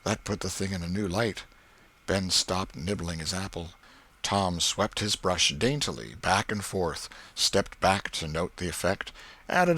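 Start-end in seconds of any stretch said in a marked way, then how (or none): none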